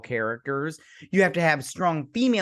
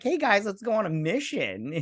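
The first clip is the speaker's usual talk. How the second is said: high breezy mock invitation; mock casual; 7 semitones higher than usual. Killers casually inviting the group along, mock breezy. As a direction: toss it off like nothing happened. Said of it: "they're all like".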